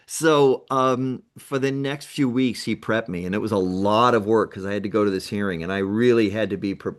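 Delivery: ordinary delivery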